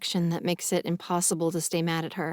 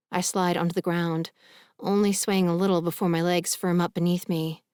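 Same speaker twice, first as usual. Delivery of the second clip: ordinary delivery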